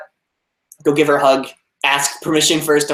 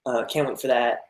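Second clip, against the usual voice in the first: usual speaking voice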